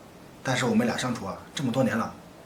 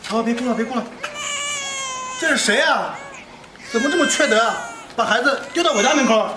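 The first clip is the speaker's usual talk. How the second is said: raised, high-pitched voice of dismay; alarmed exclaiming; 7 dB louder than usual. Acted. Man finds an abandoned baby at his door, loud alarm. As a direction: exclaim loudly over a crying baby.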